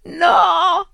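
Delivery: put-on girl screaming in horror at what she finds; horrified scream. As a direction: scream at the sight upstairs. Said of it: "l'urlo inorridito della ragazza".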